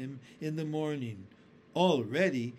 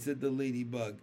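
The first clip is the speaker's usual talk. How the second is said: ordinary delivery, nothing marked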